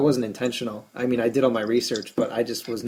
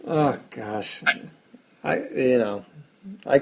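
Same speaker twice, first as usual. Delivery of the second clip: sighing, halting and defeated; beaten man; slower than usual. Real, not acted. Losing fantasy manager owning up to co-host, defeated. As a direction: trail off like someone who has given up. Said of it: "You sound like a beaten man".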